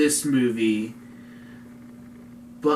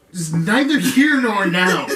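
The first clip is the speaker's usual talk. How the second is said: higher, louder half yell; 6 semitones higher, 8 dB louder than usual. Real, not acted. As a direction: raise the voice almost to a yell. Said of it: "Don't make me yell".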